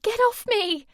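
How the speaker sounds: high, loud desperate yell; frantic shout. A narrator's reading. Sammy yells at Jay while being dragged. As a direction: shout to break his grip. Girl grabbed, fighting free.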